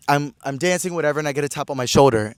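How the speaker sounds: usual speaking voice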